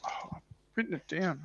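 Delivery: ordinary talk, nothing marked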